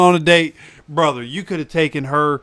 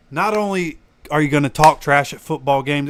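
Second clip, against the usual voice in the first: normal talking voice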